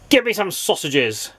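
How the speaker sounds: snapped. A narrator's reading. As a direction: bark the order at the butcher. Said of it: "snapped Mister Grumpy".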